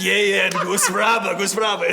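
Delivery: nervous humoring. Retold or imitated. Imitating Nicholson humoring him.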